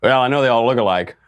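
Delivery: lightly voiced Hillary Clinton, smug quip; Hillary impression. Imitating Hillary for viewers, mild smug quip. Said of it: "do the impression for you of Hillary".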